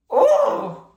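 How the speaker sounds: sudden high startled oh; a squeak of dismay at her own reflection; surprised oh. A narrator's reading. April sees her lashes are gone, high surprised oh.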